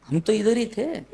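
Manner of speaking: high-pitched mock protest; high, bewildered devotee voice; mimicking a baffled devotee. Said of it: "I am mimicking him".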